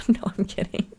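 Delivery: laugh, then whisper it; softly whispered retraction; giggling whisper. Real, not acted. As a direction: take it back in a hushed giggle. Jokes about watching porn.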